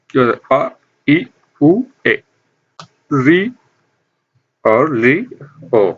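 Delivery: each short vowel अ, इ, उ said clipped and quick, one by one; short vowels demo. Shown as a demonstration. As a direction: say अ, इ, उ, ए short, like a teacher listing them. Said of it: "इनके उच्चारण में बहुत कम समय लगता है".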